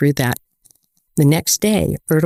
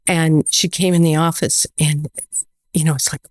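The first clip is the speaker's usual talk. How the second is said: usual speaking voice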